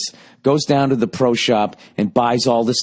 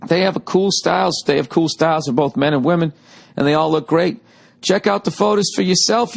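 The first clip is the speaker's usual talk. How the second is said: upbeat, higher-pitched ad read; 6 semitones higher than usual. Acted. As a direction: sell it like a radio spot. Podcast ad read.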